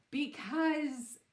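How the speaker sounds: giggling through the words; can't stop laughing mid-sentence; real laughter while telling the story. Real, not acted. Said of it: "Excuse me. laughs".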